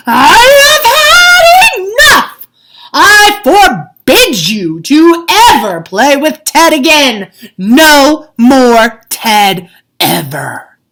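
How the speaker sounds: very loud, slow, high enraged shout; bellowing father losing his temper; furious father. A narrator's reading. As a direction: lay down the law in fury. Angry father forbids Ted.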